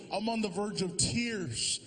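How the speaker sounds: ordinary delivery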